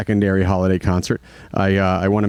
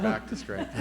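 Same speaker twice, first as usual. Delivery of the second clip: laughing; 13 dB quieter than usual. Real, not acted. Laughing while telling of illness.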